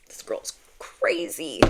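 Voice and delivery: slow, stunned whisper; whispered aside. Retold or imitated. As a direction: imitate a doctor whispering in disbelief. Doctor's aside about her refusal.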